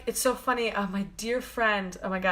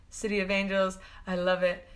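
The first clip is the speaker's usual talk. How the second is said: ordinary talk, nothing marked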